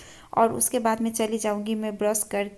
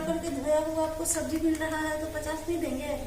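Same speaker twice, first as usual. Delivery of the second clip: vegetable seller voice; 5 semitones higher than usual. Pretend. Vendor arguing price.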